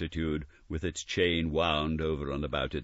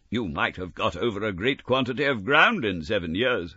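lightly voiced old miser; a hint of a wary old man; mildly voiced Scrooge; 6 semitones higher, 6 dB louder than usual. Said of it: "said Scrooge".

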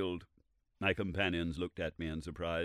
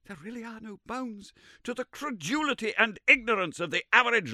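a hint of a contemptuous old scholar, higher; scornful professor; 7 semitones higher, 6 dB louder than usual. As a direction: sneer through the whole argument. Summerlee scorning the layman.

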